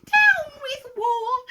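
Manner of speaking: slow falsetto protest chant; very high, stretched dinosaur call; high hoot. A narrator's reading. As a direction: hoot it out on long, high vowels. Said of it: "and he hooted".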